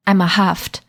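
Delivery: usual speaking voice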